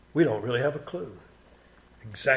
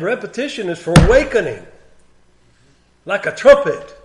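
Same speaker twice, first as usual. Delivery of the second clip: rousing emphasis; 5 semitones higher, 8 dB louder than usual. Real, not acted. Rousing sleepy listeners by repeating.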